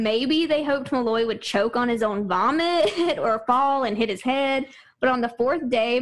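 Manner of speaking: normal talking voice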